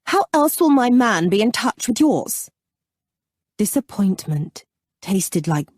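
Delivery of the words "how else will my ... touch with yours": higher, raised-voice question; calling out. A narrator's reading. Freddie calling to the Baron about valets, raised voice. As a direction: keep calling after him. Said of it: "Freddie called out to the Baron".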